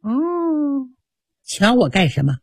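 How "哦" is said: plain reading voice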